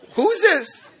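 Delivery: high puzzled voice on the phone; high startled phone voice; puzzled pilot. Retold or imitated. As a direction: ask it like a confused boss.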